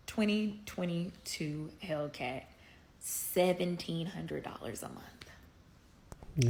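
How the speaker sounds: slow, hushed confession; whispering. Real, not acted. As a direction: whisper the price, ashamed. Confessing a huge payment.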